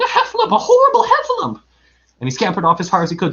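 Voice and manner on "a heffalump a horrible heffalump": very high, loud squeal for help; panicked Piglet cry. A narrator's reading. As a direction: squeal in terror as a tiny creature. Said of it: "cried Piglet".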